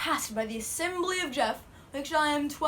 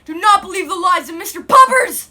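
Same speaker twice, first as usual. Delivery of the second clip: shouting; 5 semitones higher, 15 dB louder than usual. Acted. Final warning shout.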